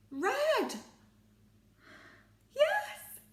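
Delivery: high, loud triumphant yes; hammed-up delight; excited shout. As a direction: cheer it out. Excited guess confirmed.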